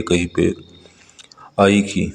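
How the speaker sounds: ordinary delivery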